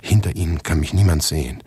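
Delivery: usual speaking voice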